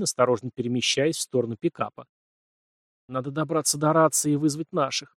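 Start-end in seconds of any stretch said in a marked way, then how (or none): none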